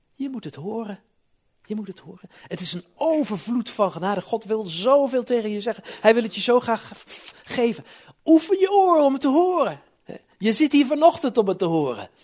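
high, rising to a loud near shout; urgent preaching. Acted. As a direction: press the listeners harder each line. Urgent appeal to congregation.

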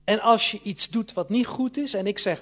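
normal talking voice

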